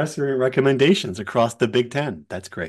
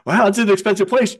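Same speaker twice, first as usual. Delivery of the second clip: teasing coach; 9 semitones higher, 4 dB louder than usual. Retold or imitated.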